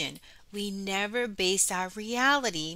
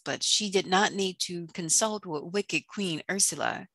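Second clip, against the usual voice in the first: ordinary delivery, nothing marked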